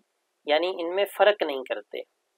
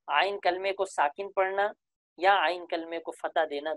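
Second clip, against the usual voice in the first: usual speaking voice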